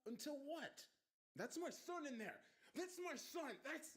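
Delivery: desperate, very high-pitched shouts; father shouting. A narrator's reading. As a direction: cry out frantically as you are held back. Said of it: "more shouts as a security guard".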